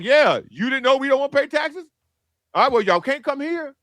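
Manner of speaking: lightly voiced smug team executive; a hint of a dismissive team owner; team bosses. Bears voiced in the story.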